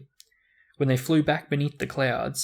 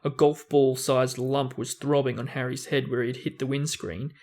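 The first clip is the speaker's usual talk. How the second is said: ordinary delivery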